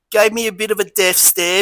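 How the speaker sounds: ordinary talk, nothing marked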